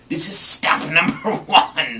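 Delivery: loud gleeful hiss with a laugh; hissing Grinch. Acted. Grinch at the first house, gleeful hiss. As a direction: gloat under your breath.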